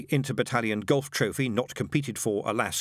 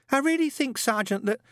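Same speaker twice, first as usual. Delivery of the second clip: put-on high, hesitant young recruit; meek protest; 13 semitones higher than usual. A narrator's reading. Newt timidly protesting to Shadwell, high and hesitant. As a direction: start to object then falter.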